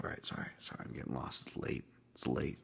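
host apologizes for rambling late at night, whispered; muttered aside. Real, not acted. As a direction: murmur it to yourself, tired. Apologizes, trailing off.

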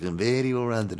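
sly foreign-butler accent, lightly put on; mock accented boast, played for fun; John Turturro in Mister Deeds. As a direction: imitate a sneaky movie character. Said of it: "John Turturro from fucking Mister Deeds".

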